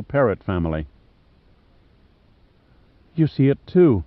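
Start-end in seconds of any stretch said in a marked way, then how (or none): 3.1-4.0 higher, louder eager discovery; lightly excited Maeve. A narrator's reading. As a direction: she finally sees it too. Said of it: "said Maeve excitedly".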